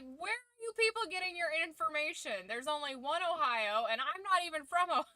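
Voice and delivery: high-pitched baffled protest, breaking into laughter; mock exasperation. Imitating an American correcting confused Brits, exasperated and amused. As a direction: act incredulous, voice up, laugh near the end.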